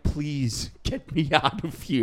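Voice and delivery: mock bovine plea, drawn out; talking cow. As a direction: beg slowly as a cow. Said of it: "a cow's like".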